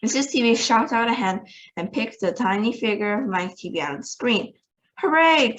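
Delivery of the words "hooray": a higher, louder cheer; cried hooray. A narrator's reading. Wonka cheering that the boy came through, loud higher cheer. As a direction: cheer in relief. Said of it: "cried Mister Wonka".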